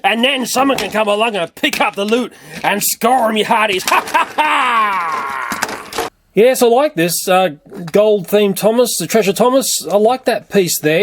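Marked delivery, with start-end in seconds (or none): 3.9-5.4 slow drawn-out ha ha ha; hammed-up pirate belly laugh. Vlogger laughing over loot, pirate laugh.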